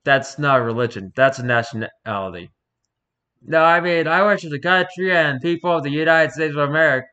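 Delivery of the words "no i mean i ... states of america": louder, higher American patriot; American accent. Acted. Interviewer professing worship of his country, loud American accent. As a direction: declare it in a hammed-up American accent. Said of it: "I really gave the perfect accent to this".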